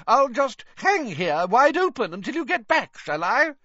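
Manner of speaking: high, loud, indignant sarcasm; hammed-up huffy fat lady; irritable calling. A narrator's reading. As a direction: call after them in a huff. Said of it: "called irritably after them".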